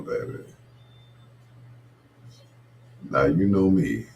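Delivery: low crooning greetings to the ladies; smooth lover's talk. Acted.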